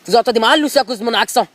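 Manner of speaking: French with an Alsatian accent; thick Alsatian-accented French. Real, not acted. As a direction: speak French like a proud Alsatian. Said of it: "j'ai un accent un peu d'Alsacien".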